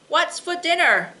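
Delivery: calling out. Acted. Husband asks his wife again from right behind her, loud call. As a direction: call it out to be heard.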